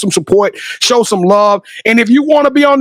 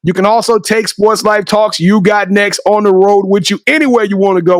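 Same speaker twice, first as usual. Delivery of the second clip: normal talking voice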